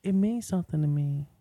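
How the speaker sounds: woman's voice. Acted. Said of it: "she said".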